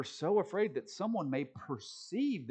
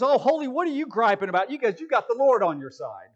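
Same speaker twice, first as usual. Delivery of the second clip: loud, higher ribbing voice; supervisor teasing loudly; 6 semitones higher, 9 dB louder than usual. Retold or imitated. Supervisor teasing holy about griping, loud and ribbing. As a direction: rib him loudly like a jovial boss. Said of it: "He goes".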